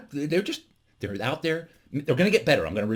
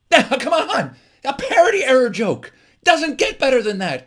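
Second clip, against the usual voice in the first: high, loud comic outrage; mock indignation; 14 semitones higher, 7 dB louder than usual. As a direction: defend the joke in loud mock exasperation. Protests after his pun.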